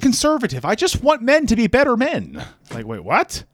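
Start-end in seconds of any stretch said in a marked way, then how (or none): 0.7-2.4 high, earnest mock Peterson voice; Jordan Peterson impression. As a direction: declare it in the parody voice. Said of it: "the impression".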